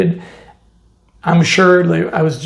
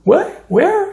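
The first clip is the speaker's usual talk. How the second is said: startled what, where; 12 semitones higher than usual. Retold or imitated. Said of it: "And he was like".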